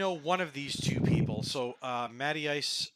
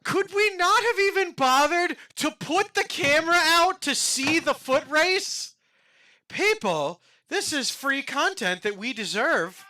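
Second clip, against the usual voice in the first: loud, high mock complaint; indignant, hammed up; mock outrage; 11 semitones higher, 5 dB louder than usual. Real, not acted. Complaining about a missed clip.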